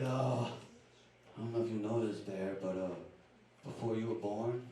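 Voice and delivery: put-on low, soft old dad voice spinning a sad story; a hint of a frail dad, hushed and somber; dad's tall tale. Dad in hospital telling son a fake sad story, low and hushed.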